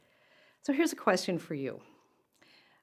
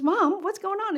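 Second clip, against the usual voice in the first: much higher, lightly voiced son's question; son calling out; 13 semitones higher, 4 dB louder than usual. Young son riding up to his mom, high curious call. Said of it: "He says".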